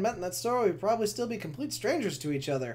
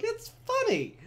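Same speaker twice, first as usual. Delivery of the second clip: much higher, squeaky mock defense; exasperated protest; 11 semitones higher, 4 dB louder than usual. Defending his loud laughing in theaters, squeaky exasperation. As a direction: throw up your hands in a pitched-up whine. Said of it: "I'm like".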